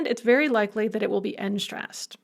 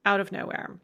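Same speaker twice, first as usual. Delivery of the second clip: ordinary talk, nothing marked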